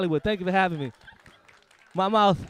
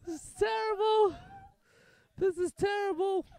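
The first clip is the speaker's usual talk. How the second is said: mock falsetto lament; 13 semitones higher than usual.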